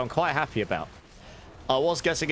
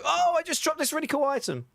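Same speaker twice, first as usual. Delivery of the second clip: much higher, hammed-up delight; mock excited gamer. Imagining a rare item drop, hammed-up excited squeal. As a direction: squeal it like you just got loot. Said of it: "to make you go".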